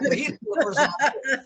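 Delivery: chuckling through the line; amused, laughing delivery. Real, not acted. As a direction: laugh as you land the punchline. Laughs at his own joke.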